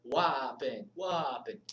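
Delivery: playful Jamaican "Wapin", punched and falling; amateur Jamaican lilt on "Wapin"; attempted Jamaican accent. Shown as a demonstration. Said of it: "can't say it in a Jamaican accent".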